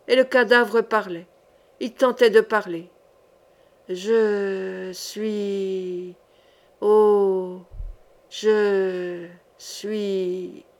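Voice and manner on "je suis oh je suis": dead man's voice. Acted. The corpse answers the sorcerer, faint and deathly. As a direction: let it barely escape the lips. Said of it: "C'étaient des sons morts".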